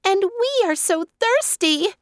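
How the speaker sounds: high, wailing little chicks; wailing sadly. Acted. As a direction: wail it sadly. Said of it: "wailed in sad tones".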